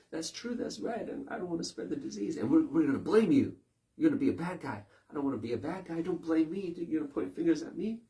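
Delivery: high, whiny, anxious voice; a scared follower pleading not to be blamed; high-pitched timid imitation, half whispered at the start; mock fearful crowd. Voices fearful compliant people.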